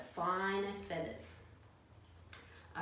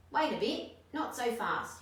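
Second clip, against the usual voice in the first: higher, louder prickly objection; bristling echidna; 5 semitones higher, 5 dB louder than usual. A narrator's reading. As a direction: bristle with impatience. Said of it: "he bristled".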